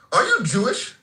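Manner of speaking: usual speaking voice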